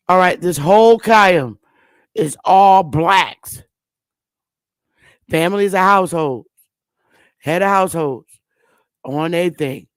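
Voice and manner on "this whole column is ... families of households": normal talking voice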